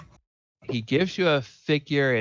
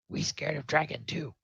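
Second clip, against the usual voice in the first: small, higher goblin voice, softer; goblin prisoner; 4 semitones higher, 5 dB quieter than usual. Acted. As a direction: confess it as a timid little goblin.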